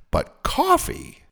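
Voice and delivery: high, slow disbelieving "But coffee?"; incredulous. Real, not acted. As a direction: stretch it out in disbelief.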